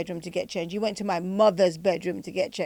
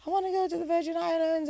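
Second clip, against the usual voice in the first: high insistent plea; wanting the Virgin Islands, high and insistent; 10 semitones higher than usual. Retold or imitated. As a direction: press your choice like a stubborn young woman. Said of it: "I was like".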